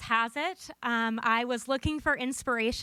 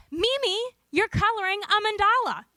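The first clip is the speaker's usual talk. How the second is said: high, loud, delighted discovery; excited exclamation; 8 semitones higher, 5 dB louder than usual. Retold or imitated. Conference speaker telling Mimi what she drew, high excited voice. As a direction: burst out with the big news. Excited granddaughter retelling her reaction.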